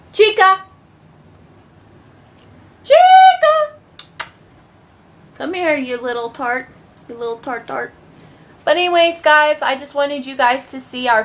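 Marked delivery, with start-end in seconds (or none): 0.1-0.6 a firm call to the dog. Real, not acted. Calling the dog over, a firm call. 2.8-4.3 high, loud, drawn-out call of the dog's name. Real, not acted. The dog doesn't come, so a long loud call. 5.4-7.9 fond teasing. Real, not acted.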